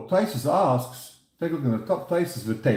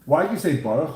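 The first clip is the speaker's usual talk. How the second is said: ordinary delivery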